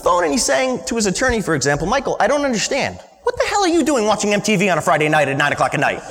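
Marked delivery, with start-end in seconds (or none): 1.9-6.0 higher, half-laughing mock dad scold. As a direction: imitate dad's exasperated question with a laugh. Dad scolding son about MTV.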